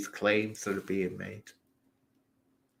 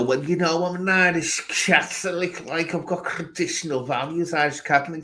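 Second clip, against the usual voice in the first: higher, mock-pious Scouser claiming Irish roots; 6 semitones higher than usual. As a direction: parody a man hiding behind his heritage. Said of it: "you go".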